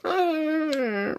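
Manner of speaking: long high-pitched whine of escaping air; deflating noise. Shown as a demonstration. Comic telling of a heart going flat, high deflating squeal. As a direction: squeal like a leaking whoopee cushion.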